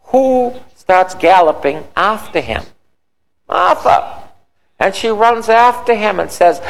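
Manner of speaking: high, animated pulpit storytelling; fervent preaching. A narrator's reading. Preacher telling the congregation of Martha running to Jesus, fervent. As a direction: tell it high and urgent, building to the quote.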